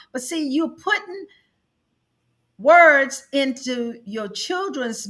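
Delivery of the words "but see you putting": ordinary talk, nothing marked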